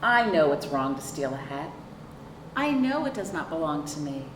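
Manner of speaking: usual speaking voice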